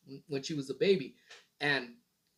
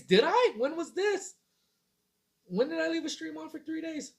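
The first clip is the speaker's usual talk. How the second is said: high, disbelieving questions; "left the stream on for three days" asked high and in disbelief; incredulous; 12 semitones higher, 3 dB louder than usual. Real, not acted. Streamer asking the chat in disbelief, high and incredulous. Surprised at a viewer's claim.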